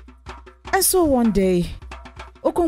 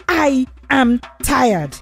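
slow, higher, word-by-word stress on "I am tired"; emphatic complaint; 4 semitones higher, slower than usual. Acted. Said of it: "he emphasized".

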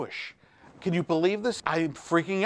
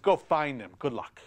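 ordinary talk, nothing marked

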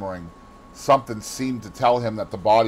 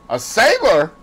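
high, loud cry of disbelief; startled cry; 12 semitones higher, 8 dB louder than usual. A narrator's reading. Dorian to the gamekeeper on hearing of a sailor, crying out. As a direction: cry it out, pitched right up. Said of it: "he cried out".

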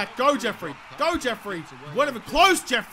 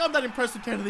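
usual speaking voice

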